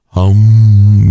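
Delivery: long, steady hummed om; meditation om. Retold or imitated. Picturing the end of Mad Men on the coast, meditation om. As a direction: intone a drawn-out yoga hum. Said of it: "on the coast doing".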